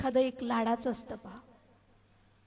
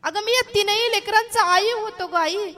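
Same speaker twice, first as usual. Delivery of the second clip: grieving cry; 7 semitones higher, 7 dB louder than usual. Acted. Son's grief outcry to mother.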